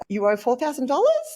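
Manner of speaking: "dollars" rising like an unsure question, high and loud; uptalk. Retold or imitated. Imitating a timid debt collector, rising at the end. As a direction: make the demand sound like a question. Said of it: "it's a question".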